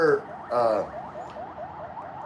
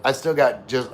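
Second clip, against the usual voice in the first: usual speaking voice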